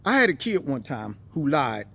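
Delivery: ordinary talk, nothing marked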